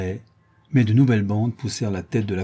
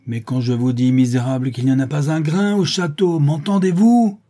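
furious voice; 5 semitones higher, 6 dB louder than usual. A narrator's reading. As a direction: let anger boil over into shouting. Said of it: "s'écria-t-il d'une voix furieuse". (voix furieuse qui monte)

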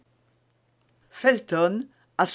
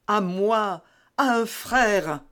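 higher, slower, lightly voiced outcry; eager cry; 3 semitones higher, slower than usual. A narrator's reading. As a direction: Felton offers himself as a brother, eager cry. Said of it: "s'écria Felton".

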